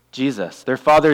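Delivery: ordinary talk, nothing marked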